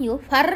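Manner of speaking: ইউফার with a heavy, trilled ending; thick R. Shown as a demonstration. Teacher shows the first R is thick, heavy ইউফার. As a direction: hold the rolled R of ইউফার. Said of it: "মোটা".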